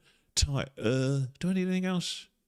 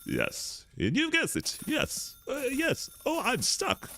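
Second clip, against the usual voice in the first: much higher squeaky yes, over and over; stuck on repeat; much slower than usual. Pretend. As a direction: chirp it like a stuck record. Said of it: "On repeat, on repeat".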